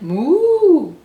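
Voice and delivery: high, loud drawn-out moo; cow moo. A narrator's reading. Cow says hello to Blue, high loud moo. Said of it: "Cow said".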